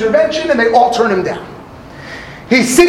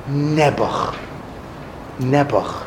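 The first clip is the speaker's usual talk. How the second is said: much lower, soft mournful Nebuch; pitying sigh; 9 semitones lower, 8 dB quieter, slower than usual. Retold or imitated. Rebbe lamenting the sinner, pitying sigh. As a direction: sigh it with pity. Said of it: "He was saying".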